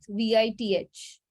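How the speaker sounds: ordinary delivery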